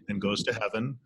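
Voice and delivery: ordinary talk, nothing marked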